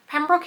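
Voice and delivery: ordinary talk, nothing marked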